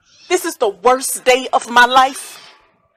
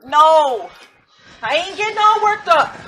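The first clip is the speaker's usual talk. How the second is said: strained denial; 5 semitones higher than usual. Acted. Denies being worked up.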